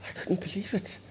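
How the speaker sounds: breathless whisper of disbelief; shocked whisper. Real, not acted. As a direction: barely voice it, stunned. Shock at seeing Karl.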